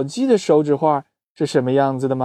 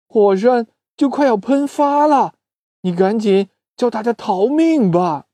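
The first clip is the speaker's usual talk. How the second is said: high, breathless alarm; alarmed warning; 4 semitones higher than usual. Acted. Bug warning Doudou the volcano will erupt, high and alarmed. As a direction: sound the alarm about the volcano.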